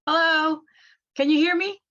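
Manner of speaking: ordinary talk, nothing marked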